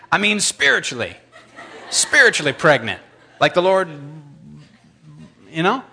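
hastily patch it up with an awkward chuckle; flustered backpedal. Retold or imitated. Backpedalling on the fly.

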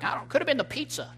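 high, quick mock doubter; dismissive mocking voice. Preacher mocking churchgoers who blame pizza, in a high quick voice. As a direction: sneer at the pizza excuse, high and fast. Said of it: "instead of being like".

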